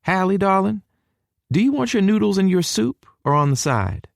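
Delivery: high-pitched Texan mother voice; high, sweet southern hostess; hospitable southern mama. A narrator's reading. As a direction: offer food in a high southern voice. Mama offers guest soup.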